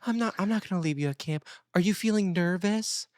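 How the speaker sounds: Megan. Retold or imitated. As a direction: imitate a mother gently reassuring her child. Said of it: "And she goes".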